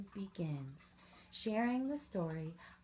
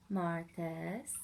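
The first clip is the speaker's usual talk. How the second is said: ordinary delivery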